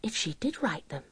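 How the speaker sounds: hushed anxious whisper to herself; voiceless whisper of a troubled thought. Acted. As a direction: breathe the worried question almost without voice.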